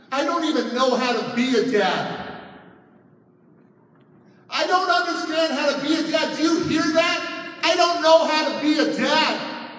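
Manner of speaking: loud, high anguished cry; retold breakdown, cried out at full voice. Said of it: "I, I cry out".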